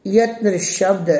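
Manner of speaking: ordinary talk, nothing marked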